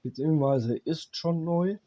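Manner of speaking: normal talking voice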